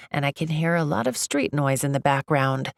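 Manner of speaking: ordinary delivery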